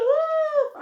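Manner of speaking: high puppy woofs; little dog yapping; playful barks. Pretend. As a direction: bark brightly for the children. Ending craft with bark.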